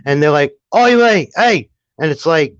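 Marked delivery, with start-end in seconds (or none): 0.7-1.7 high, louder British YouTuber shouts; mock British accent. Imitating British commentary YouTubers going off, mock British accent. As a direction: call out sharply in a put-on British accent. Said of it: "some of the British commentary YouTubers".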